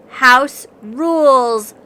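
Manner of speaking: loud slow chant together; chorused. A narrator's reading. Jostled animals reminding the husky, loudly chorused. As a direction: call it out as a group. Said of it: "chorused the jostled lemmings".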